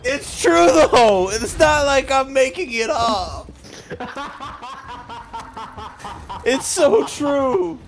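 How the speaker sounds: high, loud, drawn-out insistence; helpless hilarity. Real, not acted. Defending his coworker impression to a friend, helpless hilarity. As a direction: wail it out while cracking up.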